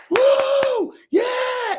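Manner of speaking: high whooping cheer; crowd cheering. Retold or imitated. Retelling the theater crowd, a high excited whoop.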